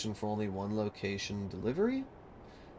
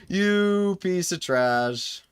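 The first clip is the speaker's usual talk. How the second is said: slow insult; 9 semitones higher, 8 dB louder, much slower than usual. Real, not acted. Cursing the game.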